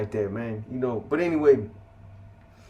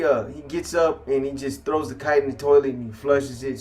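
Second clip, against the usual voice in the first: ordinary delivery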